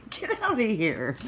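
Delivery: loud, high get-out-of-here at the cat; sharp shoo at a pet. Real, not acted. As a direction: chase the cat off, half laughing. Shooing cat off book.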